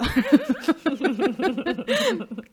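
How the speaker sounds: loud, high burst of laughter; high peals of laughter; real laughing fit. Real, not acted. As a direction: burst out laughing, long and helpless. Bursts out laughing after own remark.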